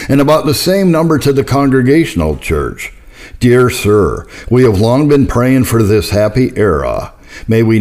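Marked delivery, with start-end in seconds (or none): none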